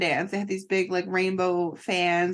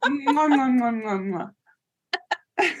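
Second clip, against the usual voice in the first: kissing noises; 4 semitones higher, slower than usual. Retold or imitated. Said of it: "your Daisy impression is hilarious".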